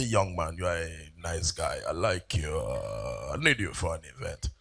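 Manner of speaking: low, quiet impersonation of a rich big man; deep big man voice. Retold or imitated. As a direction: put on a deep rumbling boss voice.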